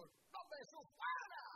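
rising to a loud high cry on fuera; casting out shout. Retold or imitated. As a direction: hurl the command at the demon. Pastor casts out the demon.

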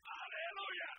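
shouted praise to the congregation; loud hallelujah. Real, not acted. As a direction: burst out in praise.

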